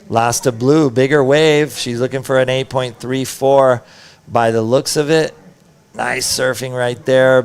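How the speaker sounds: excited play-by-play call; surf contest announcer. Acted. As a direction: call the wave as it happens.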